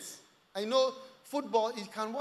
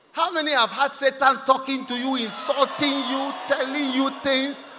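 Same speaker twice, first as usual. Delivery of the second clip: loud, slow, raised-pitch challenge; emphatic preacher's list; forceful preaching; 6 semitones higher, 8 dB louder than usual. Real, not acted. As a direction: hammer out each phrase slowly to the congregation.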